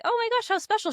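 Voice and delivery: mock-delighted oh my gosh; delighted gasp. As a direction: gush over a special gift. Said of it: "And I was like".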